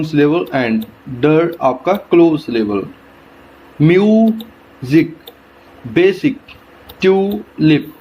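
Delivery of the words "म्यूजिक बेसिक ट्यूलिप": teacher breaking words at the syllable; split into syllables. Shown as a demonstration. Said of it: "टू parts में divide कर देते हैं".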